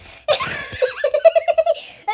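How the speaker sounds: loud high laugh; burst of laughter. Real, not acted. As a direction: let a big laugh burst out. Laughing at the chipmunk.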